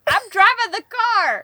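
high, slow goofy whine; silly clown. Acted. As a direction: play the clown, whiny and loud. Silly whine about only driving.

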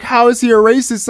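a hint of a confrontational heckler; mock indignant protester. Protesters press him angrily.

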